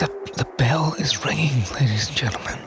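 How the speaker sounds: low, soft whispery broadcast; low séance commentary; hushed announcement. Acted. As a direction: announce it in a hush to the audience.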